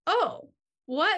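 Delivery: high, surprised reaction; dismayed surprise. Retold or imitated. Friends told at will call there are no tickets, dismayed surprise. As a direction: jump up in pitch with disbelief.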